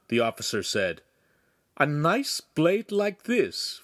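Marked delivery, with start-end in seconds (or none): 1.8-3.8 slow, lilting higher-pitched question; said lightly. A narrator's reading. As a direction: feign airy reluctance, drawing it out. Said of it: "Feltrino said lightly".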